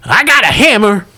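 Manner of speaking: deadpan hillbilly pilot. Acted. GM voicing Mossy Pete.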